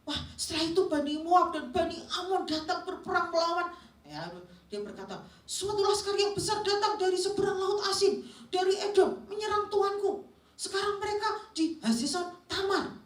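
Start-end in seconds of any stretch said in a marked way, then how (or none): 0.1-3.7 high-pitched, agitated report; acting out a winded, alarmed messenger; breathless messenger. Messenger dramatized reporting war to the king, breathless and agitated. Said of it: "dia sambil terengah-engah gitu". 5.5-12.9 high, loud, urgent alarm; panicked messenger. Acted. Messenger warns his king of an invading army, panicked. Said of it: "kira-kira kalau didramakan begini".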